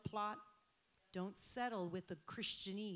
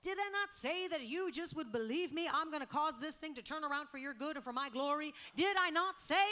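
much higher, much louder, voicing God; shouting preacher; 7 semitones higher, 8 dB louder than usual. Real, not acted. Preacher voicing God to church, loud shouting. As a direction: cry it out as God's promise.

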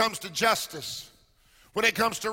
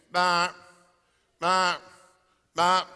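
mock busy signal; 6 semitones lower, slower than usual.

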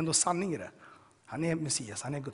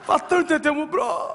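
high, loud, tearful joyful cry; ecstatic shout; 11 semitones higher, 8 dB louder than usual. Retold or imitated. Prisoner shouting his joy at the guard, high and tearful. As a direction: burst out to the guard, overjoyed and half crying.

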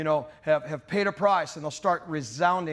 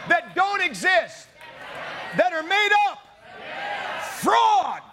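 full-force preaching prayer; 8 semitones higher than usual. Real, not acted. Preacher has the church cry to God for mercy, full-force shouted prayer. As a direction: shout each phrase out to rouse the congregation.

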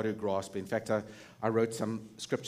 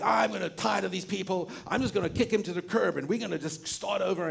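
mock-fed-up God, higher and quick; lightly voiced weary deity; exasperated God; 5 semitones higher than usual. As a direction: play God done with these people. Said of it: "God's like".

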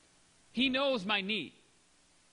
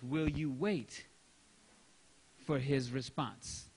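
slow, lower, drawn out; slow probing question; 7 semitones lower, slower than usual. Real, not acted. Preacher challenging congregation, slow and low. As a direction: let each word hang.

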